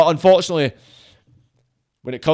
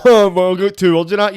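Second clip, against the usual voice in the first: laughing dismissively, louder and higher; mocking laugh; 5 semitones higher, 7 dB louder than usual. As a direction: act out someone laughing off a thick accent. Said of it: "just laugh and go".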